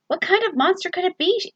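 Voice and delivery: lightly voiced young girl, curious; Eliza wondering. A narrator's reading. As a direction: Eliza asks herself about the monster, with a high, wondering voice. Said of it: "Eliza thought".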